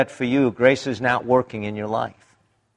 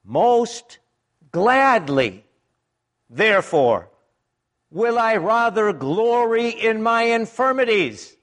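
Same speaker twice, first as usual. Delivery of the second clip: higher, lifting joy on most gladly; glad resolve; 7 semitones higher, slower than usual. Retold or imitated. Preacher voicing Paul's glad acceptance of his thorn. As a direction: quote Paul as though he truly welcomes the pain. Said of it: "here's Paul's attitude".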